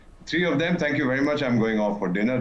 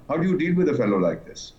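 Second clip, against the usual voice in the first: usual speaking voice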